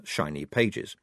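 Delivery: ordinary delivery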